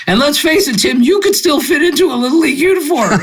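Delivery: high, fast, laughing through the joke; teasing a short friend while laughing; laughing tease. Retold or imitated. Bench teasing him with a joke.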